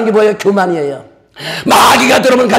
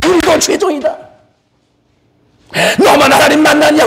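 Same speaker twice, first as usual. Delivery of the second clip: mocking sneer; 6 semitones higher than usual. Preacher voicing Miriam taunting Moses, high mocking whine. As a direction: taunt him in a shrill mocking whine, much higher. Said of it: "그 얘기한 거예요".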